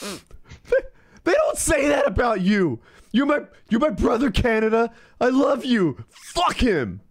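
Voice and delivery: high, hammed-up tearful wail; mock crying. America crying over brother Canada, overwrought mock sobbing. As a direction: blubber with loyal indignation. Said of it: "are you crying".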